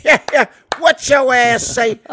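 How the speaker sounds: loud, high, drawn-out impression. Comic riffing on the fly, booming slow impression.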